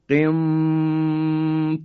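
قنطارن recited with the ن hidden and nasalized before a heavy ط; ikhfa recitation. Shown as a demonstration. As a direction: Quranic recitation with a nasal hum. (تلاوت قنطارن با اخفای نون)

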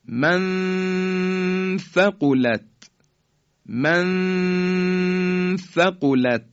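من ثقلت with the نون hidden in a long nasal hum, very slow; من ثقلت chanted slowly, nasal; ikhfa recitation. Shown as a demonstration. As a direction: recite من ثقلت holding the ghunna. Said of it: "مثال بعدی".

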